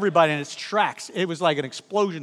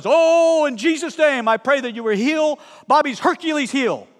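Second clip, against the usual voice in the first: high, loud, forceful prayer shout; aggressive prayer warrior; 7 semitones higher, 4 dB louder than usual. Retold or imitated. Muscular minister loudly praying over pastor's injured heel. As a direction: pray over him like a warrior storming heaven. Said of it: "aggressive prayer warrior".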